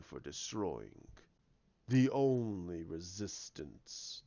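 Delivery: very low, slow villain voice; slow, deep gloating of a villain; sinister villain. Acted. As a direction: draw the words out with dark menace. Plays the villain in retelling.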